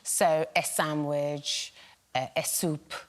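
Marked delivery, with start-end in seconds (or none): 0.5-1.7 S sandwich said slowly with an eh before sandwich; extra E sound. Retold or imitated. Panellist imitating her mum's eh-sandwich. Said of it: "she'll put an, an, an E". 2.4-2.9 S soup with an eh before soup; extra E sound. Retold or imitated. Panellist imitating her mum's eh-soup. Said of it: "she'll put an, an, an E".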